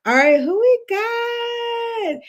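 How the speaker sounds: very high, slow sing-song call; host calling out to the chat in a high slow sing-song; playful sing-song. Real, not acted. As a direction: drag it out like a game-show host. Checking livestream chat.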